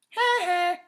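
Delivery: high mocking little laugh; bratty sister giggle. A narrator's reading. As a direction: snicker at him, teasing. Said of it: "Anna laughed".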